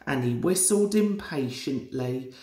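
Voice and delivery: ordinary delivery, nothing marked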